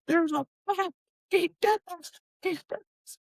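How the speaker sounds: very high, squealed retold scream; mock screaming. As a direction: imitate a stabbed sibling screaming to mom. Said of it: "I remember just screaming".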